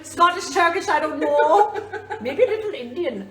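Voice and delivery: high, loud mock Turkish accent, laughing; silly foreign accent. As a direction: ham up a vague Turkish accent. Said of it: "I don't know if that's a Turkish accent".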